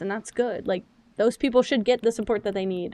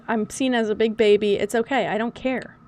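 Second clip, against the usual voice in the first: normal talking voice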